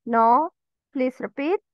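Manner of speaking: ordinary delivery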